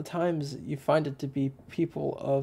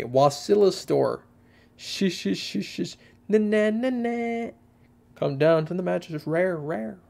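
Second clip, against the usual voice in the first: higher-pitched, hammed-up mimicry with nonsense sounds; butchered video imitation; 5 semitones higher than usual. Recalling an iconic YouTube poop opening, butchered imitation. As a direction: badly mimic a silly internet video intro. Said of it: "kind of like a butchered, uh, imitation".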